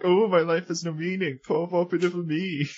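high, woeful mock lament; mock self-pity. Podcaster mimicking the character's moping, high mock self-pity. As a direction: wail it lightly, poking fun at him.

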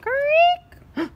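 high, loud imitated creak; mock creak; creaking noise. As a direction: squeak like a rusty wheel. Wheel sound effect.

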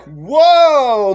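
loud, high, drawn-out whoa; astonished crowd reaction. Retold or imitated. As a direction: react stunned, voice swooping up. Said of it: "was immediately like".

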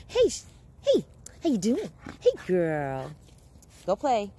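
high, loud, sing-song granny greeting; grandmother's greeting. Retold or imitated. Imitating her grandmother greeting everyone in a high, loud voice. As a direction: greet them like grandma did. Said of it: "I remind myself of my grandmother".

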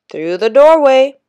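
loud, high Jamaican guard calling; Jamaican accent. Acted. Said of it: "spoke with an accent that Danny really liked".

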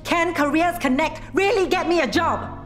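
angry challenge. Acted. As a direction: throw the question at her. Jobseeker challenges clerk.

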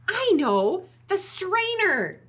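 loud, high exclamation; excited storybook outburst; sudden idea. Acted. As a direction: announce the rescue plan urgently. Sudden idea to rescue Max.

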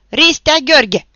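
angry shout. Acted. Toderici angrily calls the roll, shouting. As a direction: bark the name out in anger. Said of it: "strigă mânios".

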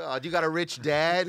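high, laughing mock jab. Teasing a comic who brought a camera, laughing mock jab. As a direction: tease the guy with the camera. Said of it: "You'd be like".

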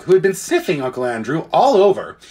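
usual speaking voice

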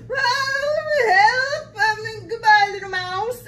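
high, loud yelp; frightened fox. Acted. Fox fleeing at sight of Gruffalo, high loud fright. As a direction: yelp for help and flee.

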